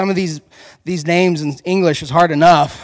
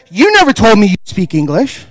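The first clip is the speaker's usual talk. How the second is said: betrayed; 4 semitones higher, 7 dB louder than usual. Retold or imitated. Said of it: "He's like".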